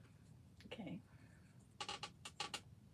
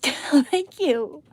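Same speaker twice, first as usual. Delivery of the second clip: laughing; 8 semitones higher, 12 dB louder than usual. Real, not acted. Laughing thanks to chat.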